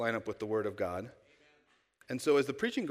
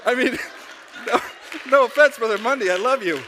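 much louder and higher, laughing through it; laughing apology; 11 semitones higher, 13 dB louder than usual. Real, not acted. Pastor teasing Brother Mundy, loud laughing apology. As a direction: burst out laughing as you apologize.

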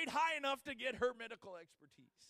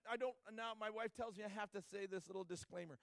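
ordinary delivery

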